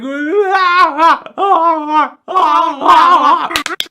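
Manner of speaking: loud, very high cackling shriek; squealing fit of laughter. Real, not acted. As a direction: lose it completely. Cracks up at meme.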